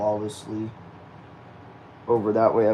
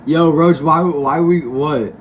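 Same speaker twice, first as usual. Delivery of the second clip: calling out; 6 semitones higher, 10 dB louder, slower than usual. Real, not acted. Calling out to a viewer.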